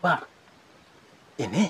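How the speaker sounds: normal talking voice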